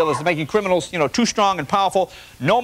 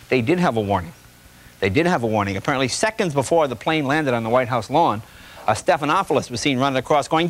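ordinary talk, nothing marked

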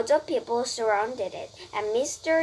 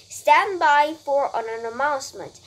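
louder public announcement; booming loudspeaker; 3 semitones higher, 8 dB louder than usual. A narrator's reading. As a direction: boom out a call to stand by. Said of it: "boomed the voice of the loudspeaker".

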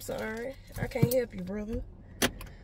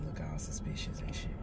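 whisper; 11 dB quieter than usual. Real, not acted. Trying not to be noticed.

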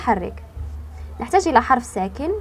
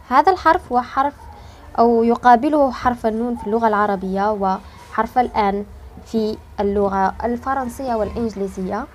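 ordinary talk

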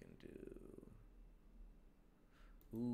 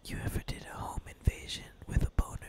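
fast breathy whisper; 6 dB louder, faster than usual. Pretend. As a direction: hiss it secretively. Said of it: "I didn't like my whispering".

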